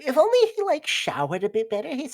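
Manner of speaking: high, swooping doting mom voice; gush, then fuss in a high voice; fussy mother. Acted. Mother judging her child's friend, high fussy voice. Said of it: "Your mother".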